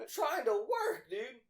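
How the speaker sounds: slow, high, loud complaint; acted exasperated protest. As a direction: plead loudly with the rowdy boss. Protesting owner's horseplay, acted.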